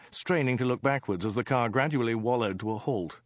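usual speaking voice

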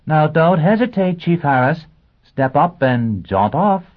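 loud, high, projected instruction; addressing the class. Acted. Teacher urging a pupil to jaunt off, loud and high. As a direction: sing it out to a pupil across the room.

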